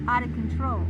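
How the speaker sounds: out of control dropped low and loud; low emphatic repeat. Real, not acted. Repeating for viewers, out of control low and loud. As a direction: repeat it deep and heavy. Repeated phrase, lower take.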